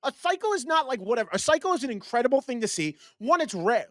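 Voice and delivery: very high, insistent no no no; indignant protest. Real, not acted. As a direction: squeal in disbelief and argue back. Keeps protesting the cycle dismissal.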